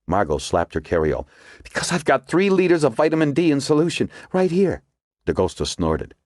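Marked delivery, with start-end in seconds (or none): 1.6-4.8 higher, urgent woman character; Margot's voice. A narrator's reading. Margot explains her theory to the agents, urgent and higher. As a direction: voice Margot pressing her discovery.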